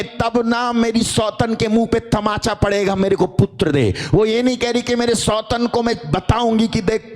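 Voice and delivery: high, intense preaching pitch held throughout; impassioned preaching. Acted. Preacher voicing Hannah's plea to the congregation, high and fervent. Preaching climbs to full force.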